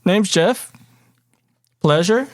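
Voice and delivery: lightly voiced eager normal guy; Jeff. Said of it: "just a normal guy. He goes".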